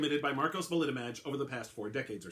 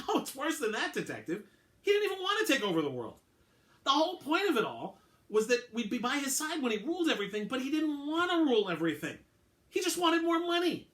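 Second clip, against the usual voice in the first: very high, straining voice, getting louder toward the end; bitter, anguished clone; 14 semitones higher, 4 dB louder than usual. Acted. Belitomaj tells the detective the master only wanted money, high and strained. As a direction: push up into a strained, near-breaking high register.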